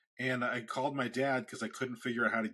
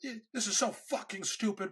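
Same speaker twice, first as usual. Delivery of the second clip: much higher imitated yell of a furious dad; hammed-up angry father, much higher; mock screaming dad; 10 semitones higher than usual. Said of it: "He's, like, screaming".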